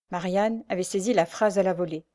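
ordinary delivery, nothing marked

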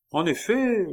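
éclat furieux, très aigu et fort; sorte de fureur. A narrator's reading. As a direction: attaquer avec fureur. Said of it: "avec une sorte de fureur". (avec fureur)

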